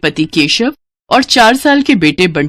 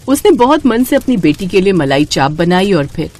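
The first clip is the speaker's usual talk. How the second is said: usual speaking voice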